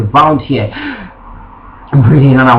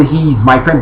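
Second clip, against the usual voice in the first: ordinary talk, nothing marked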